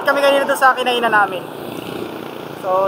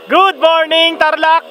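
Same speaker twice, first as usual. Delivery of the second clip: cheerful greeting; 5 semitones higher, 4 dB louder, much slower than usual. Real, not acted. Cheerful morning greeting to camera.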